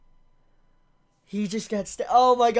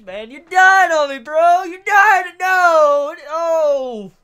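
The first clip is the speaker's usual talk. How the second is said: high, loud wail of grief; anguished outcry; 4 semitones higher, 8 dB louder, slower than usual. Real, not acted. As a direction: plead loudly with someone slipping away. Pleading with dying character.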